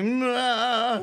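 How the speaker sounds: mock casual nah. Retold reply to invitation.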